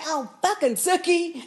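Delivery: high, indignant retelling; outraged at their nerve; high mock outrage; indignant mock quote. Indignant retelling of vampires' line.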